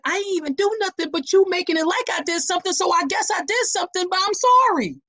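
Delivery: pleading, bewildered, rushing to apologise; flustered apology. Retold or imitated. Confused partner apologising for nothing she did, high and fast. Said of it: "And you're like".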